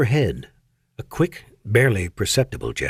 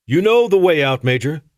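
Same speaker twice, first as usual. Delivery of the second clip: loud, higher, curt send-off; angry dismissal; 6 semitones higher, 4 dB louder than usual. Acted. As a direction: throw her out with a raised voice. Dismissing her in anger.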